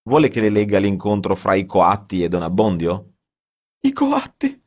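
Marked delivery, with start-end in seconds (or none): 3.8-4.6 1 aghast. A narrator's reading. Writer aghast echo.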